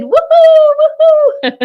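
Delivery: high, loud, slow cheer, then a laugh; whooping. Real, not acted. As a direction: whoop for joy. Celebrating tour announcement.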